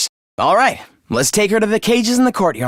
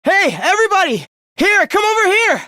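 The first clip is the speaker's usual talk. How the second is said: high, carrying call to the group; 9 semitones higher than usual. Acted. Calling the others over to a discovery, urgent shout. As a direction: summon everyone over urgently.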